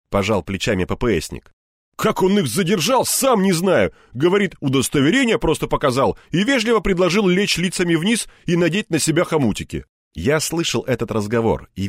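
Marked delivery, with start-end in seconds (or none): 1.9-9.8 higher-pitched lively colleague; оживлённый коллега. Acted. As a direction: marvel at the story, pitched up. (оживлённо, повышенным голосом коллеги)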